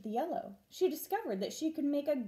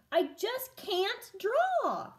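frustrated sulk; 10 semitones higher, 4 dB louder, much slower than usual. Acted. Vashti frustrated with her teacher.

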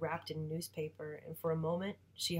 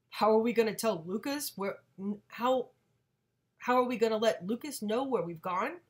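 higher, louder mimicking voice; mocking imitation; 4 semitones higher, 8 dB louder than usual. Father mimicking mom's question about Lucas, mocking. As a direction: parrot her question to belittle her.

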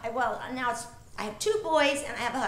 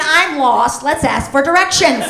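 loud doll-voice playback; canned doll line, loud; talking doll; 10 dB louder than usual. Pretend. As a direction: chirp it like a pull-string toy. Doll voice from pulled string.

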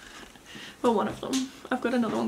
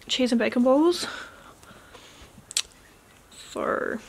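ordinary talk

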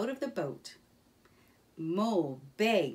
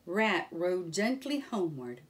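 ordinary delivery, nothing marked

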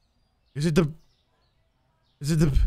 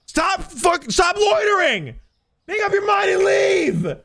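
yelling at customers; 14 semitones higher, slower than usual. Acted. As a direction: blow up at the loiterers to get out. Clerk yells at loitering customers.

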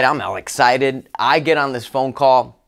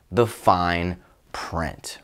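low, slow, dropped to a near hush on the fine print; slow stressed phrase; 7 semitones lower, 5 dB quieter, much slower than usual. Real, not acted. Speaker warns the audience about contracts, slow and weighty. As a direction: lean hard on each word, slowly.

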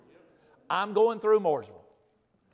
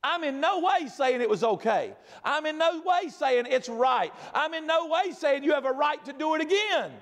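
raised, slow, emphatic preaching; full-force preaching; 7 semitones higher than usual. Acted. Preacher pressing the point on his congregation, emphatic. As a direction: drive each line home to the congregation.

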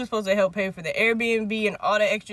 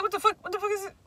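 higher-pitched baffled imitation; retold disbelief; 9 semitones higher, 5 dB quieter, faster than usual. Imitating her reaction to the little weed, baffled. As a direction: puzzle over it in a high acted voice.

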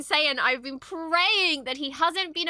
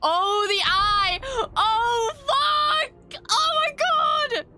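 high, loud squealing cries of delight; excited shrieks; 13 semitones higher, 4 dB louder, much slower than usual. Real, not acted. Fan shrieking at the episode's stunning shot. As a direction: shriek at the screen in awe.

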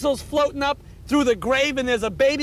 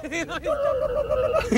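laughing; 11 semitones higher, slower than usual. Real, not acted. Laughing while retelling the jump.

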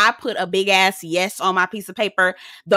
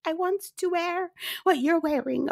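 high begging voice; 9 semitones higher, 5 dB quieter than usual. Retold or imitated.

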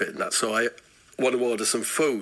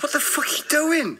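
startled protest. Retold or imitated. Acting his reply to attacker.

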